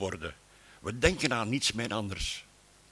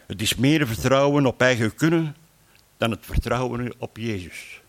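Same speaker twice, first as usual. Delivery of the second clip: ordinary talk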